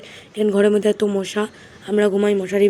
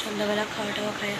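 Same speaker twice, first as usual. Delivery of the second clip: speaking softly; 9 dB quieter than usual. Real, not acted. Student vlogging while mom is on the phone, speaking softly. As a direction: keep it down so nearby phone talk isn't disturbed. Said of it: "আমি এত আস্তে কথা বলতেছি".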